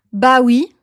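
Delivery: loud, rising French "bah oui"; as if it's totally obvious; emphatic duh-style yes. Shown as a demonstration.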